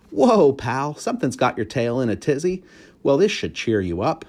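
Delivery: cheerful janitor. A narrator's reading. Aldo's voice.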